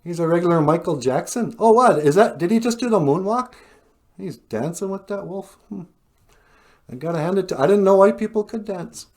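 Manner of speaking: high-pitched, impressed disbelief; acted-out astonishment; amazed onlooker.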